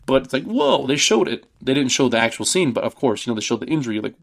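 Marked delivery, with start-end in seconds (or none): 0.4-1.5 a much higher, astonished "Whoa"; amazed whoa. Retold or imitated. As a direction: let the whoa leap up in awe. Reacting to the injury shot.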